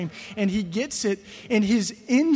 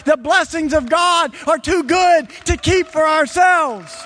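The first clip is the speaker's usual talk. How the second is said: higher, louder preacher's build-up; rising preaching; 6 semitones higher, 6 dB louder than usual. Acted. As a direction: preach it with growing fire.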